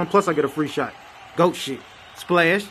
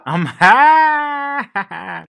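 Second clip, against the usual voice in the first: higher and louder, a happy outburst; excited cry; 6 semitones higher, 6 dB louder than usual. Acted. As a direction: shout it up, thrilled. Excited shout.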